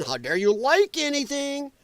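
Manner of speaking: high, drawn-out mock indignation; mock outrage. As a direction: imitate an indignant scold, pitch swooping up. Mimics scolding political opponents.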